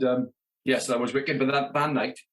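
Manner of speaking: ordinary delivery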